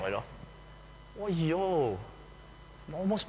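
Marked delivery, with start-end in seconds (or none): none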